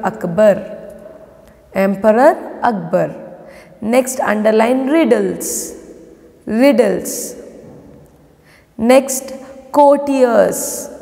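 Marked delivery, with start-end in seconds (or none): none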